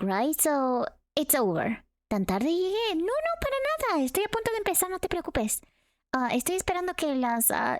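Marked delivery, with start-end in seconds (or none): 2.1-5.6 high-pitched voice. Acted. Streamer voicing a late chat viewer.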